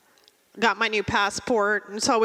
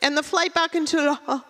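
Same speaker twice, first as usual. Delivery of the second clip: fake French accent; 4 semitones higher than usual. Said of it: "She goes".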